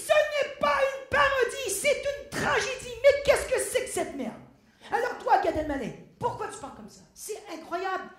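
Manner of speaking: loud, high-pitched theatrical indignation; mock outrage. Dancer tearing into star actors on stage, mock outraged. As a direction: declaim it like an overblown tragedy.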